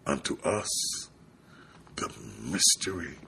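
low, soft, sinking into a whisper; hushed reverence. Acted. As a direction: let the verse fade to a whisper. Hushed close of a repeated verse.

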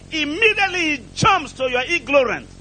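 normal talking voice

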